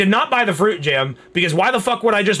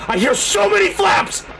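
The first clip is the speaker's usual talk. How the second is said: high-pitched alarmed yell; panicked shout; 13 semitones higher than usual. Real, not acted. Player hearing monster wings flapping, high panicked shout. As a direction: cry out in real alarm, pitched way up.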